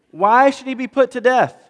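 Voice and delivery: high, loud protest, slowing at the end; a son crying out against his father; pleading protest. Acted. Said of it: "Jonathan asks his father".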